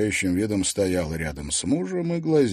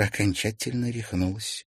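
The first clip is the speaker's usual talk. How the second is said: usual speaking voice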